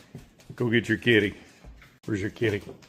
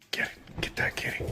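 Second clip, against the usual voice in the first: whisper; 12 dB quieter than usual. Real, not acted. Egging on dog at cat.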